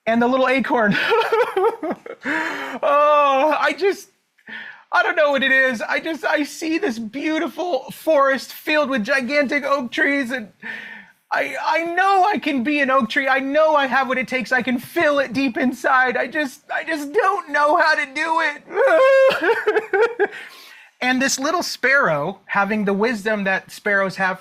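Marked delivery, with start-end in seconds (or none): 1.0-2.2 laughing. Real, not acted. Laughs while voicing acorn. 2.2-18.6 high, half-laughing mock sob; mock crying acorn. The acorn moans to the sparrow in a hammed-up cry. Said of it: "he'd be sitting there crying every day". 18.7-20.4 laughing. Real, not acted. Laughs after acorn voice.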